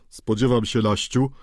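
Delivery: plain reading voice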